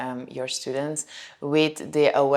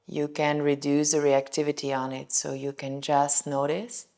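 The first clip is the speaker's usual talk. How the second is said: calm, softer voice with long pauses; guided meditation; 5 dB quieter than usual. Shown as a demonstration. Yoga teacher inviting students into a body scan, guided meditation. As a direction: lead gently through a body scan, settling softer as it goes.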